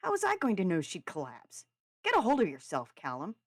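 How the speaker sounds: loud, exasperated retort; heated brotherly argument; defensive anger. A narrator's reading. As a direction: snap back, then tell him off. Brother argues back in quarrel.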